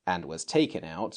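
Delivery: ordinary delivery, nothing marked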